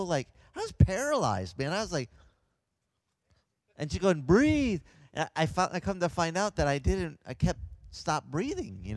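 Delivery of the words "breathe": imitated nurse's loud, high call; raised, insistent retold command; urgent order. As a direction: push it out loud to rouse someone. Nurse repeats order to patient.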